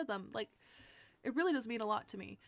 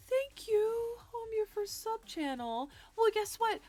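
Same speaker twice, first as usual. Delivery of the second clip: excited; 8 semitones higher than usual. Real, not acted. Gasps at a new sub.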